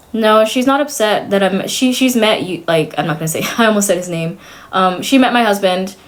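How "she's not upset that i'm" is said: ordinary talk, nothing marked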